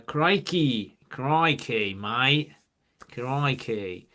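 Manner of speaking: drawn-out, slow Aussie exclamations; hammed-up Australian crikey; Australian accent. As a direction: stretch the vowels in a mock Australian twang. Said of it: "to us, all Australians sound the same".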